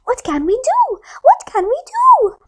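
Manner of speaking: high little fish voices; tiny fish fretting, voiced high; whispered. Small fish worrying, high pitched voices. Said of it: "they whispered".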